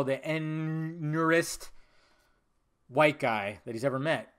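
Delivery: usual speaking voice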